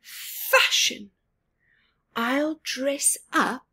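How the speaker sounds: high, eager declaration of a plan; Bob's eager voice. Acted. Bird Bob announcing his fashion plan, excited. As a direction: announce the idea with a leap up in pitch.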